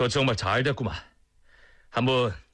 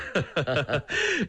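hearty laugh; 5 semitones higher than usual. Acted. Greeting the old cook warmly.

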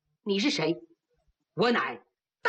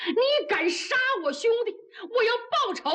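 angry shout; 10 semitones higher than usual. Acted. 愤怒叫阵.

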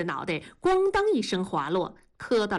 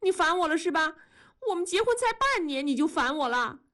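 hurt question; 8 semitones higher, faster than usual. A narrator's reading. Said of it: "文丽呆呆地看着同志问".

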